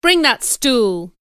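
loud, slow command; stern order. Acted. Man ordering a stool for punishment, stern and loud. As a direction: order it slowly and forcefully. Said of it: "ordered Mister Brocklehurst".

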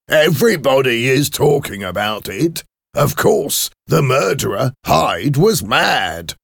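high, emphatic put-on clerk voice; head clerk character. Clerk discusses the murder with his employer, high and emphatic.

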